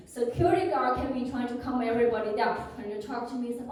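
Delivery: ordinary talk, nothing marked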